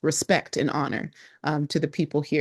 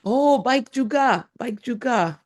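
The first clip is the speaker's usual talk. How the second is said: sing-song reply; 7 semitones higher than usual. Retold or imitated. The tourist answers the local in bright sing-song. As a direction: croon the reply warmly and high. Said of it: "sing-songy rhythm".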